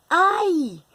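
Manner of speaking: high, loud "Ai!" cry; exclamation. Shown as a demonstration. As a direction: exclaim "Ai!" like a sudden startle. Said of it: "Então, eu posso dizer". (exclamação bem marcada)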